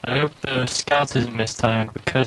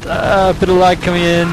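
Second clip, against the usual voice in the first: high, loud caster hype; excited play-by-play; 7 semitones higher, 4 dB louder than usual. Real, not acted. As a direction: shout the action live.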